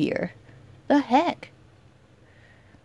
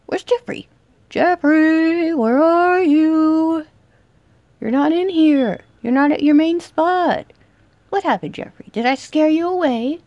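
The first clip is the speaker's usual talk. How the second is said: playful high seeking voice; mock sing-song calling; 7 semitones higher than usual. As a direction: call out teasingly. Searching for hiding killer.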